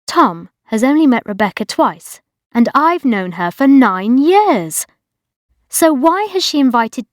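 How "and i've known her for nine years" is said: ordinary delivery, nothing marked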